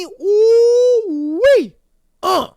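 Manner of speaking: real excitement; excited whoop. Real, not acted. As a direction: let out a delighted whoop. Anticipating hot drama.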